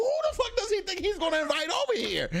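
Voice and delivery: high-pitched, laughing disbelief; incredulous outburst. Retold or imitated. Reacting to Frank inviting people over, incredulous outburst. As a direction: blurt it high, scandalized and amused. Voicing outrage at inviting guests.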